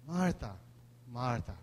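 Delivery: soft, low and caring; gentle tender call. Shown as a demonstration. Jesus gently calling Martha, soft and tender. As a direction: say her name with compassion. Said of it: "Most likely yung pangatlo na yun".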